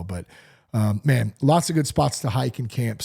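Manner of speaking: ordinary talk, nothing marked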